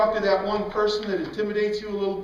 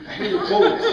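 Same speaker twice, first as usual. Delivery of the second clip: said high with a laugh in it; 12 semitones higher than usual. Real, not acted. A preacher laughing as he tells the church he hated bullies. Repeated line, first laughing.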